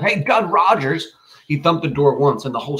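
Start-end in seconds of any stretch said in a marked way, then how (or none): 0.0-1.1 high, drawn-out holler; raised-pitch narrated bawl; bawled call. A narrator's reading. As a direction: call out slowly to someone indoors. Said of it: "Franklin bawled".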